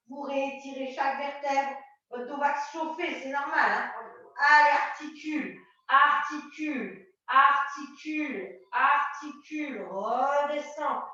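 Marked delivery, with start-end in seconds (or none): 4.7-11.0 slow, stretched-out instruction; drawn-out cueing. Real, not acted. Coach pacing a careful roll down, slow and drawn out. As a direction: stretch each word to pace the movement.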